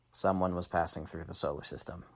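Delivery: plain reading voice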